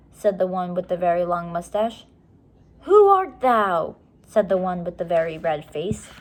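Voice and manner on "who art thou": loud, slow, higher mock guard; guard's challenge. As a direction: boom the old-fashioned challenge slowly. Guard challenging captured children.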